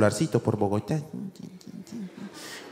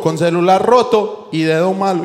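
ordinary delivery

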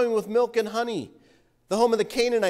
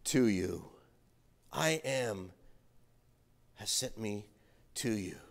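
solemn voice of God; 10 semitones lower, 4 dB quieter than usual. Acted. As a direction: let it sink softer and lower, with long pauses. God's solemn answer read aloud.

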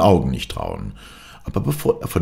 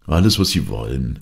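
plain reading voice

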